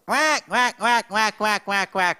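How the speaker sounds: high, loud angry quacks; angry duck. Retold or imitated. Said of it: "een boze eend".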